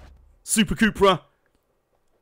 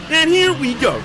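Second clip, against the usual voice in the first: high, loud excited call; 11 semitones higher, 6 dB louder, slower than usual. Real, not acted. Streamer as the race launches, high excited shout.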